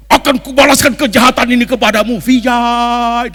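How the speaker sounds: loud, high hammed-up vow of a film hero; Bollywood hero vow. Preacher mimicking Indian film hero vowing revenge. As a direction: declaim it like an Indian movie hero swearing revenge. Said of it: "lihat nggak mau ngomongnya".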